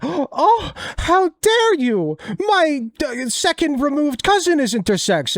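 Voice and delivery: high, hammed-up pearl-clutching voice; mock offended gasp. Mocking transphobes who fake outrage, high hammed-up offense. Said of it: "They're like, gasps".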